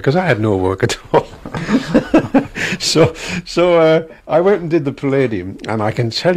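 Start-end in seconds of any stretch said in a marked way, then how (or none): 1.3-2.7 a high burst of real laughter. Real, not acted. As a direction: laugh at your own joke. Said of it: "laughs at his own joke".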